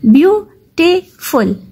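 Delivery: Beautiful slowly broken as "byu - ti - ful"; split into three syllables. Shown as a demonstration. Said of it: "इसमें तीन syllable है".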